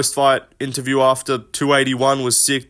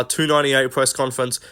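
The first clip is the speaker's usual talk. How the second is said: usual speaking voice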